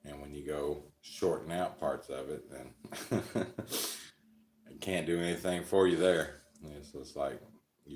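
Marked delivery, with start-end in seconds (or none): 2.8-4.1 short breathy chuckle, high; a little laugh at his own line; quick laugh. Real, not acted. Laughing at his own brain joke, breathy chuckle.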